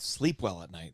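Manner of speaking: a hint of a low, soft, smug doctor; mock doctor. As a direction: quote the doctor low and hushed, mocking. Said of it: "the first doctor who said".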